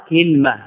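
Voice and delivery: كلمة as kil-mah, two syllables; كلمة closed up as KIL-mah; kasra on the kaf. Shown as a demonstration. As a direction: say كلمة with i after kaf, no vowel on lam. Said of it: "بكسر الكاف مع سكون اللام".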